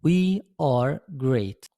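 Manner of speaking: very slow, spaced-out English answers; teacher giving quiz answers at a crawl; slow answer reading. Shown as a demonstration. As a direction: read the answers out slowly word by word. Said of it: "الإجابة هتكون".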